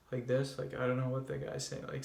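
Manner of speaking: soft, fast mumbling; demoing a nervous low-volume mumble; quiet mumble. Shown as a demonstration. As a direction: rush it under your breath. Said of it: "talking at a low volume".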